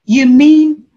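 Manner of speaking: high, loud amazed disbelief; as if the answer were too simple to be true; stunned client voice; incredulous surprise. Retold or imitated. Withdrawer amazed in session.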